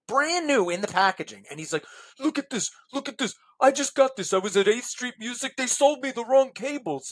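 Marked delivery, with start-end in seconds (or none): 2.2-7.1 higher-pitched imitated griping; agitated complaint. Buyer showing the seller his new cables, agitated complaint. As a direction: imitate a worked-up customer airing a grievance.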